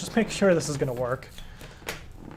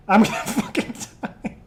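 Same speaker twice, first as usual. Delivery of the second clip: ordinary talk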